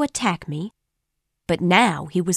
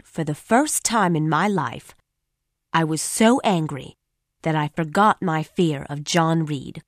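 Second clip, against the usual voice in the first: ordinary delivery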